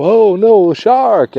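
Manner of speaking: high, loud mock cry of alarm; mock panic. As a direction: cry out in hammed-up alarm at a shark. Acted crowd panic at shark.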